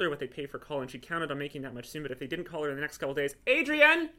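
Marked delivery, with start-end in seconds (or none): 3.5-4.1 loud, high yell. A narrator's reading. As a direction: yell for her across the house. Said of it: "yelled Glenn".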